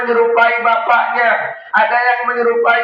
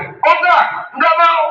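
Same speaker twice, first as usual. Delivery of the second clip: firm refusal; 7 semitones higher than usual. Retold or imitated. Imam refusing the devil disguised as his father. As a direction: throw it back at the devil.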